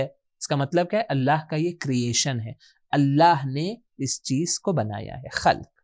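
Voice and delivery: normal talking voice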